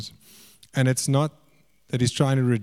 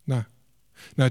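usual speaking voice

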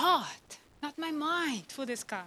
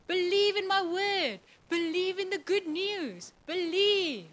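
higher, ringing repeated Believe; building plea; 5 semitones higher than usual. Acted. Preacher voicing God's call to believe, fervent and high. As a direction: preach it with rising urgency.

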